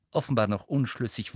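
ordinary delivery, nothing marked

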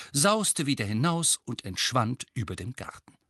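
ordinary delivery, nothing marked

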